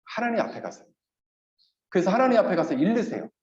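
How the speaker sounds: normal talking voice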